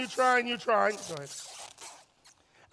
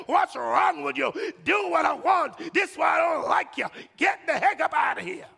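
frustrated shouting; 2 semitones higher, 4 dB louder than usual. Acted. Partner lashes out at one who can't reach, frustrated shouting. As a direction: punch out each demand angrily. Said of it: "they're getting frustrated with you".

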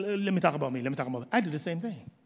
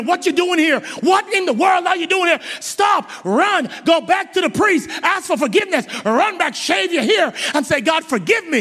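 higher, louder pleading yell; urgent shout; 7 semitones higher, 5 dB louder than usual. Acted. Preacher yelling at Samson to turn back, urgent shout. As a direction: shout him back to the priest.

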